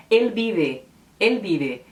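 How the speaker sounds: El Vive as el BI-be, clear l; Spanish El Vive. Shown as a demonstration. Teacher demonstrating Spanish El Vive, v as b. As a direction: say El Vive with b sounds, slowly. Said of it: "as duas letras V se pronunciam com som de B".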